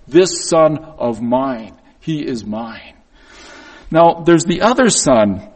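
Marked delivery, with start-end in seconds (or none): 0.1-2.9 slow, weighted words to the world; a father staking his claim; slow proud claim. Retold or imitated. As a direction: lean on This and let He is mine drop soft. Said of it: "making a statement to the world".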